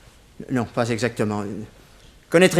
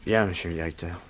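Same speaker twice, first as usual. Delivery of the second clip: much lower and softer, resigned; resigned submission; 9 semitones lower, 9 dB quieter than usual. Acted. Prisoner yielding to the director's warning, low and resigned. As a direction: give in, barely audible.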